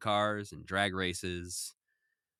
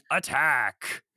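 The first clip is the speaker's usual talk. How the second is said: mock battle cry, a bit louder; screamed robot attack; 3 semitones higher, 6 dB louder than usual. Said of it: "flies in through the window screaming".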